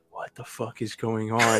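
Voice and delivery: soft, slow bewildered mumble; groggy, just woken, drawn-out and hushed; retold sleepy confusion under the breath; dazed. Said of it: "in a daze".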